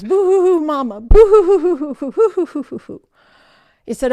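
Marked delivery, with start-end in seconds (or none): 0.0-3.0 high, loud hammed-up boo-hoo wailing; exaggerated comic crying; mock sobbing. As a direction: blubber like a child for mama. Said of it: "I burst into tears".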